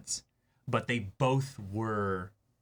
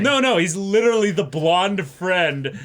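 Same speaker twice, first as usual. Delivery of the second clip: laughing; 9 semitones higher, 13 dB louder than usual. Real, not acted. Laughing, arguing the joke.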